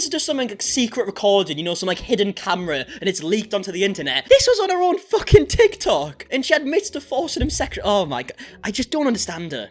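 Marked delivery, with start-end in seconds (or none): 4.3-7.8 outraged. Real, not acted. Outraged at her video.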